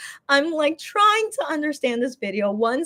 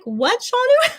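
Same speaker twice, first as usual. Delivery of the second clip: much higher, astonished "What, Shanu?"; incredulous what; 11 semitones higher, 3 dB louder than usual. Retold or imitated. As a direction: squeal it in disbelief. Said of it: "I'm cracking up again because I'm like".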